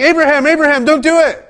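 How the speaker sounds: loud, high call from the angel. Retold or imitated. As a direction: call out urgently to stop him. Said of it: "the angel ... saying".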